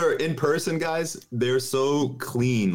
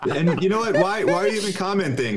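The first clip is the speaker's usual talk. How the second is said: laughing, pitched-up mock rant; 7 semitones higher than usual. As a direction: laugh through a teasing comeback.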